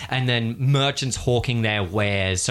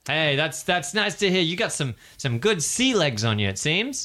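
recruiter sales pitch; 7 semitones higher than usual. Acted.

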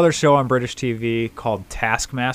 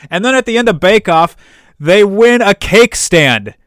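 incredulous louder reveal; excited punchline; 10 semitones higher, 12 dB louder than usual. Real, not acted. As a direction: land the absurd prize with relish. Punchline about the cake stand prize.

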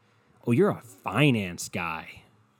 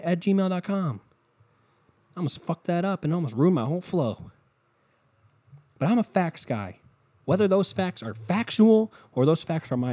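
hyped-up bragging; 7 semitones higher than usual. Acted. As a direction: sell yourself like a showman. Hyped self-promoting facts-guy rant.

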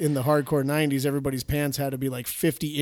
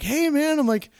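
much higher, bright radio host hello; excited DJ voice; 10 semitones higher than usual. Retold or imitated. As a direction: introduce your buddy on air, hyped. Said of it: "and I'm like".

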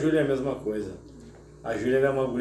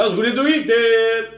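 football cheer; 6 semitones higher, 5 dB louder, slower than usual. Real, not acted. Cheering his football team.

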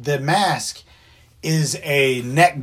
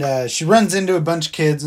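usual speaking voice

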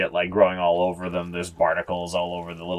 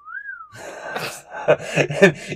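high sliding wolf whistle; admiring whistle. Acted. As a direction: whistle after someone walking away. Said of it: "watch him walk away and go".

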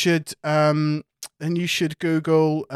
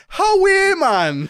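much higher, louder hammed Geordie greeting; mock Geordie; 7 dB louder, slower than usual. GM greets the table in a high, loud mock Geordie voice. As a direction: squeal it out in bad Geordie. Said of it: "that awful accent that I just did".

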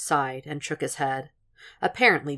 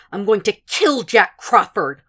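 slow, louder, higher vow with KILL leaned on; vehement swearing; 6 semitones higher, 8 dB louder than usual. A narrator's reading. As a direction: swear it slowly and forcefully. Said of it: "she swore".

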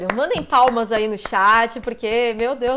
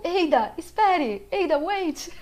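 drawn-out, higher-pitched call; quoting a hero's call; imitated game catchphrase; 5 semitones higher, slower than usual. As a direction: call out the game line slowly. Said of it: "pra você fazer o".

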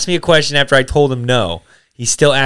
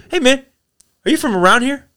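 higher-pitched voice for a stranger asking; gas station stranger; 11 semitones higher than usual. Retold or imitated. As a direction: imitate a man at the gas station, pitched well up. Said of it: "and the guy goes".

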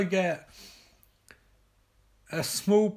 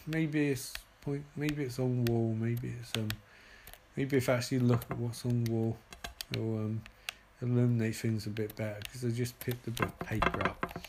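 lower, softer, fast muttering; muttering to self; 6 semitones lower, 6 dB quieter than usual. Real, not acted. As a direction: think aloud under the breath. Muttering while searching.

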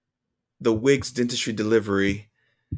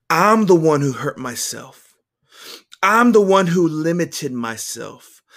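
loud, higher, pained admission; heartfelt, emphatic self-blame; anguished confession; 5 semitones higher, 5 dB louder than usual. A narrator's reading. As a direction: own up to it with rising anguish. Anguished confession read from journal.

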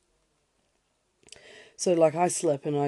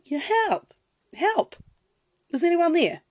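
high, loud cries for help; calling for help; 14 semitones higher, 7 dB louder than usual. Retold or imitated. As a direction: shout up from the crevice. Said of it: "he yells".

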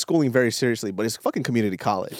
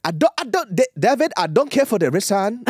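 high-pitched mock African scolding; lecturing African accent; 8 semitones higher than usual. African classmate lectures him for lateness, imitated accent, scolding. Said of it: "he would, like, lecture me".

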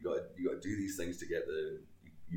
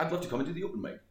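eager request; 12 semitones higher, 4 dB louder, faster than usual. Retold or imitated. Retelling his polite request.

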